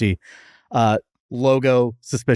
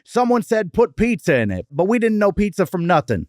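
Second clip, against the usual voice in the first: put-on higher-pitched old-school Chicago voice; Chicago grandfather imitation; 7 semitones higher than usual. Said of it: "I'm literally imitating my, my grandfather".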